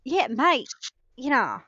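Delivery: put-on Australian accent, easy and matey. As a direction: play a casual Aussie. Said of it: "get into your Australian".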